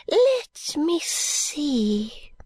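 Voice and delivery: put-on high, fluttery old lady, whispering bits; a rambling old woman clinging to hope; old Miss Flite.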